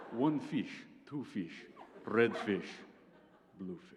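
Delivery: slow, separated words in a Russian accent. Shown as a demonstration. Comic reading Doctor Seuss aloud to the audience, Russian accent. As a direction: read it like a heavy Russian, pausing after each phrase. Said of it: "read them in a Russian accent".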